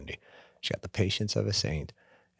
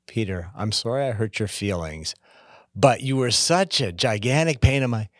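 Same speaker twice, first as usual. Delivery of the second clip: exasperated fake apology, louder and higher toward the end; bad apology building to an annoyed outburst; mock bad apology; 4 semitones higher, 6 dB louder than usual. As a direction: voice a grudging apology that turns to blame. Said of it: "the minute you put the word but".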